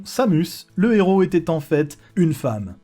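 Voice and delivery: ordinary talk, nothing marked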